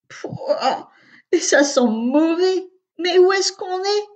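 put-on high disgusted little girl voice; a small child wrinkling her nose at the smell; little sister voice. A narrator's reading. Agathe smelling the whale belly, disgusted little girl. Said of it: "la petite sœur d'Augustin".

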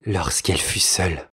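plain reading voice

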